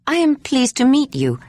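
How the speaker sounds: lightly voiced sharp-eyed older woman; Bresci's voice. A narrator's reading. Bresci returns Ayla's greeting in her own lightly voiced manner.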